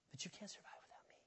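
whispered threat; menacing aside, barely voiced.

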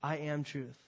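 soft, low and slow; Jesus's claim spoken gently and low; quiet declaration. Acted. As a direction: say it softly, each word weighted. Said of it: "Jesus said".

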